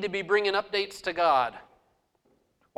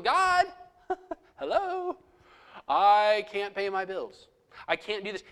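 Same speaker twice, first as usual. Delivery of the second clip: mock panicked prayer. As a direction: play a worried believer crying out to God. Preacher mimics a panicked prayer.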